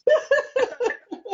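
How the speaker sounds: high laugh; bright high laugh. Real, not acted. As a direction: burst of high real laughter. Laughing at the story.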